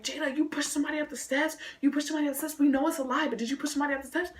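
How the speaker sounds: high, quick classmate voices; questioning friends. Retold or imitated. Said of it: "my friends and everybody coming up to me like".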